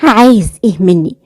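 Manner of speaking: ordinary delivery, nothing marked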